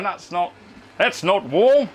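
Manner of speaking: a deliberately bad Australian accent, brushing off British heat; terrible Australian accent. Imitating Australian viewers scoffing at his heat, bad Australian accent. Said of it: "That was a terrible accent".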